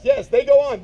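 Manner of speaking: ordinary delivery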